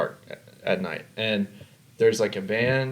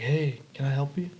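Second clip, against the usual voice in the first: lightly voiced stranger, softer and higher; weird foreign guy; 4 semitones higher, 5 dB quieter than usual. Stranger offering help with the trash, lightly imitated weird foreign guy. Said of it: "It's some weird foreign guy".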